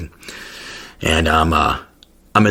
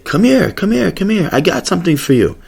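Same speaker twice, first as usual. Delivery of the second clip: high, coaxing come-here voice; beckoning pharmacist; 12 semitones higher than usual. Retold or imitated. Pharmacy guy waving a tourist over, high coaxing call. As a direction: lure him over to the counter, sing-song and high.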